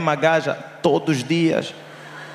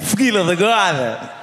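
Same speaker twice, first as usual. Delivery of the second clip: "filha da goda" in a European Portuguese accent, raised; Portuguese accent with "filha da goda" lifted a little; 4 semitones higher, slower than usual. Shown as a demonstration. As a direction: say "filha da goda" like a Lisbon local. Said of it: "Com sotaque mesmo português".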